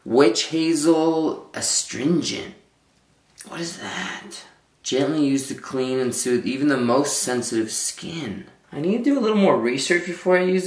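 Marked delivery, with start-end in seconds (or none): 3.5-4.4 whisper. Real, not acted. Puzzled at odd product.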